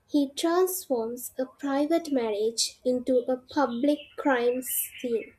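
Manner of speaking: normal talking voice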